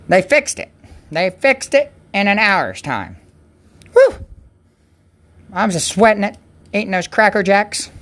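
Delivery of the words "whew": high, loud whew of relief; exaggerated relieved whew; sigh of relief. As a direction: blow out the tension. Relief after engine was fixed.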